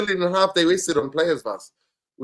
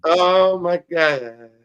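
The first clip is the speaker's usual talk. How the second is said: slow, drawn-out, higher and louder oh my God; exasperated; 4 semitones higher, 7 dB louder, much slower than usual. Real, not acted. To his friend about the manager's claim, a drawn-out exasperated groan. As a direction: stretch it out in disbelief.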